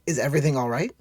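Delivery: put-on high, kindly young woman voice. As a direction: ask kindly, naive and caring.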